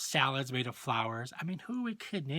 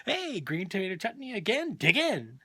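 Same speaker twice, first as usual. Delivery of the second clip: mock-eager kids, higher pitch with a swooping lilt; boys yelling; 6 semitones higher than usual. Mother imagining her three boys at chutney night, mock-eager yell. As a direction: cheer it like boys at dinner. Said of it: "those three same boys yelling".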